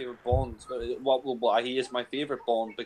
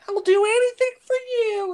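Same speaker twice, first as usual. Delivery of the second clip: piercingly high, loud mousy squeal; high-pitched mimicry; 7 dB louder than usual. Showing co-host what grates in her accent, loud squeaky mimicry. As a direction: exaggerate her squeaky American accent in mockery. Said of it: "It's so, like, high-pitched".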